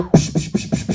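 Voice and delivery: quick unvoiced psh slapping sounds; imitated slaps in a whisper; slapping noises. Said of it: "start slapping shit".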